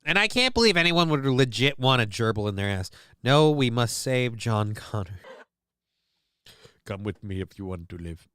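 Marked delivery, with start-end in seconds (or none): 3.2-8.3 deadpan killer robot line; Terminator voice. Pretend. Quoting Terminator lines.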